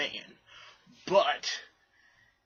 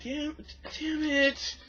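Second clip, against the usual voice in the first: much higher, strained curse; frustrated curse; much slower than usual. Retold or imitated. Missing the ball.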